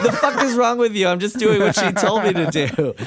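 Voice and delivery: loud, laughing New York snap; angry New York outburst. Retold or imitated. Cut off in line, snapping back New York style. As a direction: let your inner New Yorker yell at the guy. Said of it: "my inner New York got out".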